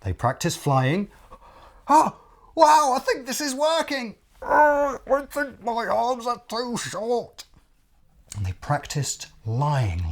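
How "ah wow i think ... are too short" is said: very high, excited then deflated; high crocodile trying to fly; crocodile character voice. Acted. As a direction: flap and fail in a high voice. Crocodile tries flying.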